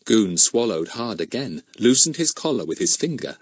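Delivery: plain reading voice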